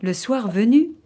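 ordinary delivery, nothing marked

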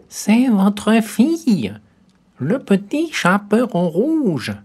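high, slow, loud put-on girlish voice; the wolf mimicking the little girl; wolf faking a girl's voice. A narrator's reading. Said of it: "en contrefaisant sa voix".

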